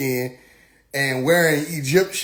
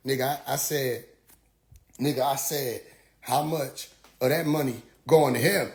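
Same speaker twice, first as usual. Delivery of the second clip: low, soft, patient repeat; understated insistence; 4 semitones lower, 6 dB quieter than usual. Retold or imitated. Questioner asking where the money goes, quietly insistent. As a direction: keep the question low and dogged.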